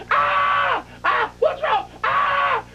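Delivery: high, screamed back-and-forth panic; mock screaming. Imitating Brandy and Sherry screaming at each other, mock scream. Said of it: "there's so much screaming".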